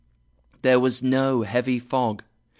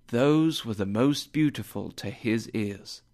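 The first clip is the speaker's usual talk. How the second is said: plain reading voice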